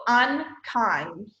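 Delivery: UNKIND slowly split, UN, pause, KIND; two separate syllables. Shown as a demonstration. Teacher demonstrating the chin trick, UNKIND in two slow syllables. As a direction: draw out both beats of UNKIND. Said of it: "that's a syllable".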